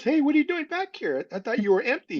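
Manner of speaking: high-pitched imitated call-out; starts very high, mock surprise; retold surprised greeting. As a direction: quote someone puzzled to see you back. Retold surprise at his return.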